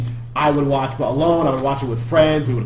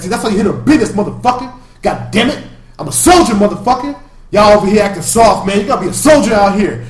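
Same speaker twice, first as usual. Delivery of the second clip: louder, higher, swaggering brag; hyped-up boast; 6 semitones higher, 8 dB louder than usual. Retold or imitated. Enforcer bragging to his crew after the robbery, loud swagger. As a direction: pump yourself up, tough guy.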